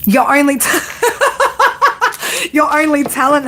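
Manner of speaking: high laughing tease, words broken by giggles; giggling playful mockery. Real, not acted. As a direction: laugh through the jab at her. Laughing while teasing the viewer.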